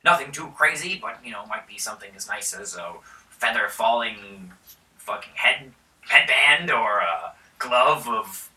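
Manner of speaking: a hint of a generous lord bargaining; mildly grand baron; lightly voiced baron. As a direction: offer the gold grandly. Baron offers reward.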